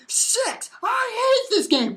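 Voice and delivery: high, squealed frustration; mock mad. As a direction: whine it out in a put-on high voice. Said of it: "I was getting so mad".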